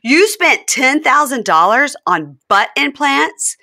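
hammed-up stunned fiancé; mock shock. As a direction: stretch out the outrage. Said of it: "And he goes".

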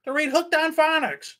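high, slow dumb-sounding answer; mock inmate reply. Mocking an inmate's law library trip, slow high voice. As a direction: play the clueless inmate, drawn out. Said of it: "They're like".

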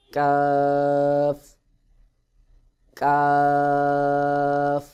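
কাফ made just forward of the tongue root, vowel held very long; long, sustained recited কাফ; fronter K. Shown as a demonstration. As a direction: draw out কাফ on one level tone. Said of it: "কাফ উচ্চারণ হবে".

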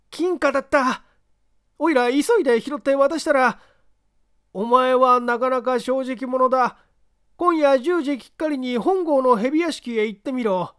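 high, eager frightened confession; nervous street tough. Acted. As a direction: spill it all nervously.

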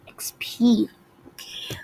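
hushed aside; whisper. Real, not acted.

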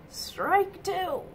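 ordinary delivery

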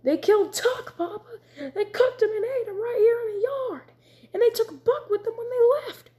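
put-on high, tearful child pouring out the news; excited child voice. A narrator's reading. Young son telling his father about the raid, upset and tearful. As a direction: blurt it out, near tears. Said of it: "Zek said excitedly".

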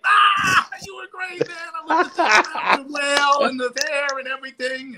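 very high-pitched mock gushing voice; gushing praise. Idol finally praising the kid's set, high gushing voice. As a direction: burst out high, then draw out the compliments.

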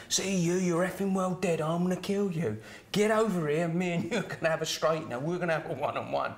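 mock yelled challenge; retold angry yelling. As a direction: call someone out for a fight, voice lifted. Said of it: "the bloke was screaming some more".

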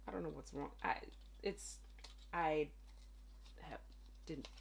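usual speaking voice

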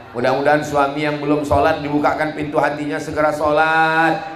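usual speaking voice

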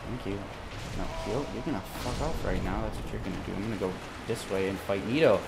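low, soft muttered threats; near whisper, calm. Real, not acted. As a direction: keep it hushed and low to stay calm. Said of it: "I'm sorry if I'm whispering".

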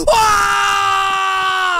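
loud panicked scream. Acted. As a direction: scream as you flee. Running away in panic.

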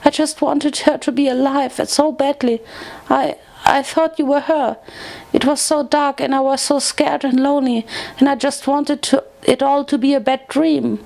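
high-pitched, pleading friend; Fluttershy, distraught. A narrator's reading. Grieving friend confessing she was scared and lonely, distraught. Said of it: "she cried".

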